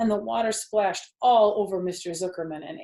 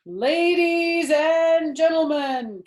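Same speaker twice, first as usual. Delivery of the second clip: loud, high, slow announcer call; loudspeaker announcer; 10 semitones higher, 9 dB louder, much slower than usual. Acted. Fair loudspeaker calling the crowd to attention, booming. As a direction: boom it out over the crowd.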